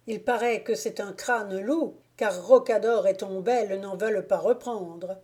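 plain reading voice

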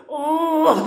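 long, high wailing "aah"; sustained high mock wail; imitated crying out. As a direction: cry out as if from the grave. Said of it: "diye bağırıyor böyle".

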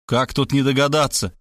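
plain reading voice

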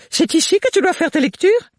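loud, high fussy mother; bossy matron pushing her daughter; overbearing mother. Acted. Mother voiced again.

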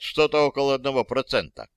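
plain reading voice

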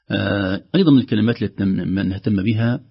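ordinary talk, nothing marked

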